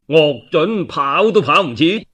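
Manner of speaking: plain reading voice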